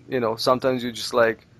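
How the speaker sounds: ordinary talk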